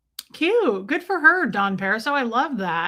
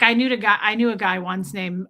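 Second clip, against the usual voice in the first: normal talking voice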